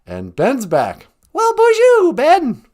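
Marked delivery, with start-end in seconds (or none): 1.3-2.6 high, loud sing-song bonjour; excited greeting. Real, not acted. Host greets Ben in the chat with a high, loud cheer. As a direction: cheer his arrival.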